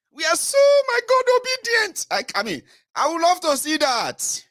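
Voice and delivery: very high mocking exclamation; sarcastic gush. Speaker mocking obedient citizens with a high sarcastic gush. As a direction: drip with irony.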